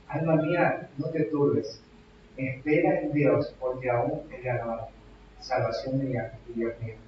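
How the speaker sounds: soft, hushed words to your own soul; gently calm your troubled soul; tender self-talk. Acted. Preacher modeling how to speak to his own soul, softly.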